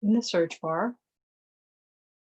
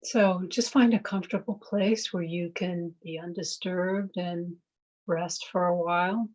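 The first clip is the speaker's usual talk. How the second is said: guided meditation. Real, not acted. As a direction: ease them into noticing the breath. Leads a mindfulness meditation.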